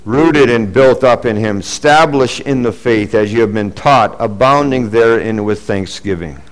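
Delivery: solemn scripture reading. Acted. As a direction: read the verse with weight.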